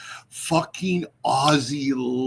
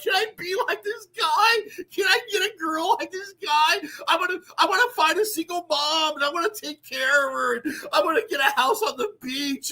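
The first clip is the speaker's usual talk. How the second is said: high, pleading mock whine; whiny wannabe; 9 semitones higher than usual. Mocking Canadians who want to copy Michael, high whiny plea. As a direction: whimper like a begging hopeful. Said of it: "they're like".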